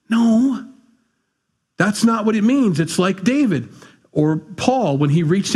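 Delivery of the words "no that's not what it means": indignant rebuttal from the pulpit; emphatic protest. Real, not acted. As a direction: jump up to shut the idea down. Preacher rejects a misreading.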